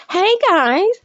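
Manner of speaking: high mocking squeal; a high sing-song mock of Nick's greeting; mocking shriek. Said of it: "I'm shrieking now, trying to imitate you".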